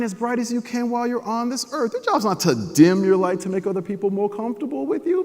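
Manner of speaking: put-on a mama lifting up her crying boy, big and soulful; loving mama. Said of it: "She said".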